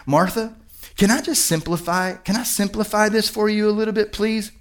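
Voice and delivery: soft, tentative request; gently pleading Jesus. Acted. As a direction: ask quietly, as a tender favor. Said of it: "I picture Jesus kinda saying".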